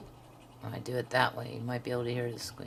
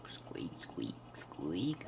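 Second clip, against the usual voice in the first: breathy whisper of "Squeak, squeak, squeak"; 8 semitones lower, 9 dB quieter, much slower than usual. Pretend. Teasing viewers about the pen squeak, whispered. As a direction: murmur the squeaks playfully under the breath.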